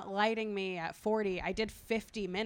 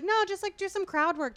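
high, louder mock boss voice; 10 semitones higher, 5 dB louder than usual. Comic mocking the club boss who tells him to stall, high and loud. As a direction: ham up the club manager's order.

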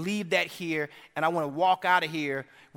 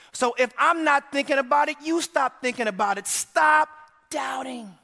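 preaching at full force; 9 semitones higher, 6 dB louder than usual. Acted. Preacher repeating the command at full force.

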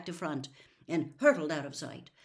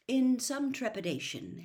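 ordinary delivery, nothing marked

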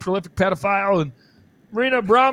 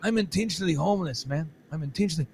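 put-on softer, low mumbling homeless kid; quiet mock hipster; 3 semitones lower, 7 dB quieter, faster than usual. Mocking a cart pusher downtown, softly drawled. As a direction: mumble it like a spaced-out drifter.

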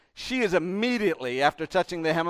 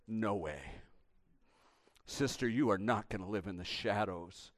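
low, soft, firm comfort; tender reassurance; 7 semitones lower, 10 dB quieter than usual. Acted. Jesus calling the woman out of shame, tender and low. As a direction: lower your voice and reassure gently.

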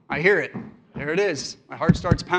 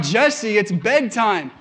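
exasperated bedtime order; 4 semitones higher, 6 dB louder, slower than usual. Retold or imitated. Tired father, third bedtime call.

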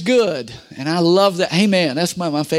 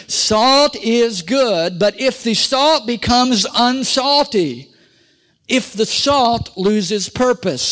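slow emphatic preaching; 3 semitones higher, slower than usual. Acted. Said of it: "slow emphatic preaching".

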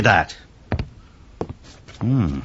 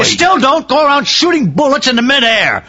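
high, raised reprimand; angry scolding; 9 semitones higher than usual. Acted. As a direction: scold him sharply. Inspector scolds barman for shooting.

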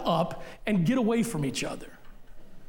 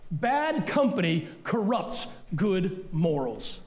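"Bad company corrupts good morals" repeated even slower, every word weighed; slower emphatic repeat; 3 semitones lower, slower than usual. Shown as a demonstration. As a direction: drive the verse home slowly. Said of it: "That's scripture".

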